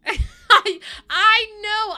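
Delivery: high, loud half-sob laugh; overwhelmed with emotion; laughing through tears. Real, not acted. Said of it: "I'm just a bit emotional".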